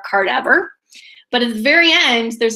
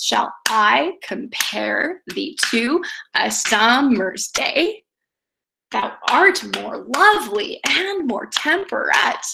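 slow verse, "shall I comPARE thee TO a SUMmer's DAY"; heartbeat stress; slower than usual. Shown as a demonstration. Teacher reads the famous sonnet, slow weak-strong iambic beat. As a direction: draw out every second syllable of "Thou art more lovely". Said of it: "same thing in the sonnet".

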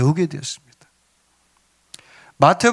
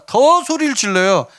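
ordinary talk

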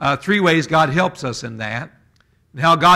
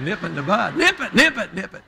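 Barney Fife, laughing; 4 dB quieter than usual. Retold or imitated. Comedian's Barney Fife repeating himself through laughter. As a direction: giggle through a jittery deputy chant. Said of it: "in the voice of Barney Fife".

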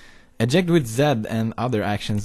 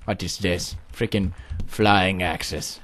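ordinary talk

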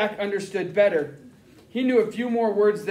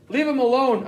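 higher and louder, a desperate scream; 7 semitones higher, 6 dB louder than usual. Acted. Boy protecting his brother from the interrogator, screaming. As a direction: shout to stop the man hitting him. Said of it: "I screamed".

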